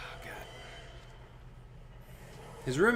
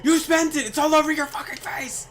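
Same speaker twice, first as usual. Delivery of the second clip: high, much louder furious yell; angry yell; 10 dB louder than usual. Retold or imitated. Roommate yelling at the guy about the candy, high and loud. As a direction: blast him for lying. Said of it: "He's like, it's like".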